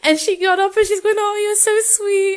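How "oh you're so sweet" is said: high, cooing thanks; imitated touched reply. Wrestler rising to hug fan, high imitated cooing. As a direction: imitate someone touched by a compliment, pitched up.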